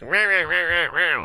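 playful imitation of a chattering cat; cat yelling. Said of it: "She's, like, yelling at me".

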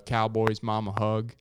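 ordinary delivery

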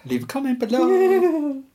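high, slow YouTuber plug; sing-song leave a comment. Pretend. Mocking YouTuber sign-off.

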